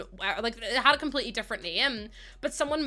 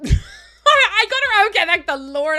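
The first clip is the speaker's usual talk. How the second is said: laughing triumph; 8 semitones higher, 11 dB louder, slower than usual. Real, not acted. Triumphant laugh after finding it.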